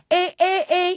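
loud, hard glottal eh attacks; glottal bang. Shown as a demonstration. Coach demonstrating violent chord onsets. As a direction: bang the cords together on each eh. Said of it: "you hear my chords, like, bang".

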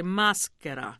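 "maschera" with the first syllable leaned on; Italian "maschera", weight on ma; accent on MA. Shown as a demonstration. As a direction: coach "maschera", stressing the opening ma. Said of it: "you want the accent on the ma".

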